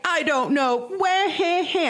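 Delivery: high, wailing imitation of crying; mock sobbing. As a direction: whine it, breaking into fake wah-has. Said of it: "that's literally what it sounded like".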